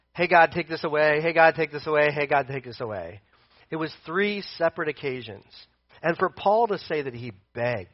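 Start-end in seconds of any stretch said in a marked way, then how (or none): 0.1-3.2 mock casual request, rattled off on a level pitch; offhand repeated prayer. Preacher parodying a casual prayer to God, offhand and monotone. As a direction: toss it off by rote, fading at the end. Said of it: "And so it wasn't like".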